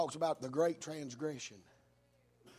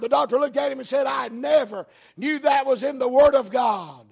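loud, raised pulpit storytelling; full-force preaching; 5 semitones higher, 11 dB louder than usual. Real, not acted. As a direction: drive the story home with shouted peaks.

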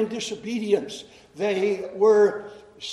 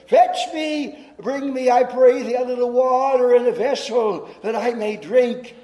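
higher, louder call from the prophet; prophet's raised, pleading request; Elijah calling out; 4 semitones higher, 5 dB louder than usual. Acted. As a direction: call to the widow across the gate. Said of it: "Elijah called to her and said".

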